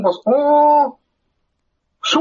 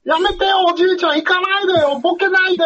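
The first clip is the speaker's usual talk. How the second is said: high, pleading daughter voice. Retold or imitated. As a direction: beg grandpa up high.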